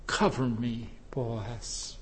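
low, very soft plea; hushed plea. Acted. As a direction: plead quietly, as if humbly asking for shelter. Voicing Ruth's plea to Boaz.

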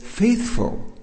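"Faithful" leaps high with a falling tune; emphasis on faithful. Shown as a demonstration. Preacher stresses Paul's first word to the church. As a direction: lift "Faithful" high and let it fall. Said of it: "an emphasis in the first position".

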